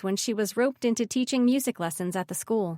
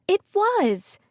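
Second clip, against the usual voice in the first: insisting; 11 semitones higher, 5 dB louder than usual. Acted. Ridley insists against teasing brother.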